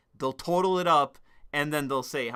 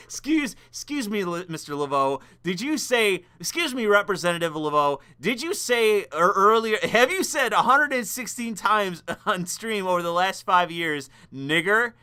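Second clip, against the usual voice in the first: hammed-up pompous reporter asking a gotcha question, louder and higher; mock reporter; 5 semitones higher, 5 dB louder than usual. Mocking a reporter who questions a streamer about a slur. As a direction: play a smug interviewer grilling a politician.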